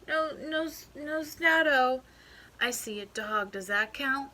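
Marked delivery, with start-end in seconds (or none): 0.1-2.0 stuffed-up report. Acted.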